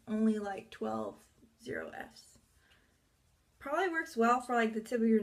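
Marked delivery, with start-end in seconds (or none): 0.7-2.4 deep voice. Pretend. Deep mock voice.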